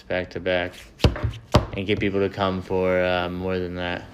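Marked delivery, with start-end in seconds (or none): none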